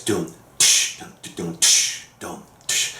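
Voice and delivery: low "doom" and hissed "tsh" beatbox; vocal kick and crashing snare noises; imitated drum groove. Shown as a demonstration. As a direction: mouth the beat, sharp "tsh" for the snare. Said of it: "whatever is substituting for the snare".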